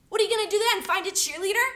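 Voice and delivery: loud, high, derisive question; scornful challenge. Acted. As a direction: throw it at them in angry disbelief.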